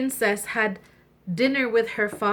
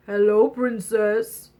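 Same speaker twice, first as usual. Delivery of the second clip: slow frog greeting; 4 dB louder, slower than usual. Acted.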